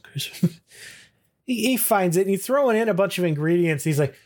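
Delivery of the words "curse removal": whispered under the breath; trailing whisper. Retold or imitated. Man searching his Rolodex, trailing off in a whisper.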